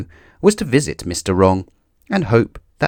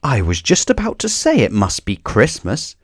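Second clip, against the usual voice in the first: building to a high, loud cry of triumph; rising with delight to the last words; exclaimed; 12 semitones higher, 4 dB louder than usual. A narrator's reading. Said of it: "he exclaimed".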